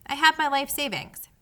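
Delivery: a touch of self-pity, pleading; lightly whining mouse; pathetic voice. Acted. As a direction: play the poor mouse, mildly pitiful. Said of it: "he said in a pathetic voice".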